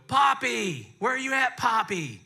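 imitating a grandkid calling grandpa; lightly voiced child call. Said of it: "She taught him how to go".

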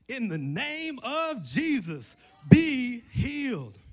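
high, loud, slow healing command; booming slow in the name of Jesus; bold commanding proclamation. Retold or imitated. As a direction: declare it with full gangster boldness. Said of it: "so boldly, so savagely, so gangster".

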